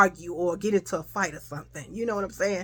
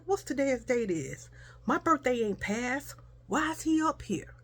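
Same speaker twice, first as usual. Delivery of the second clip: under my breath; 7 dB quieter than usual. Retold or imitated. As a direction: grumble it quietly so nobody hears. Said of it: "I thought I had said it under my breath".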